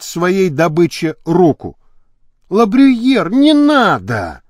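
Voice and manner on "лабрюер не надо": higher, drawn-out plea; a woman calls out to stop him; woman's voice. A narrator's reading. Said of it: "раздался женский голос". (женский голос, протяжная мольба)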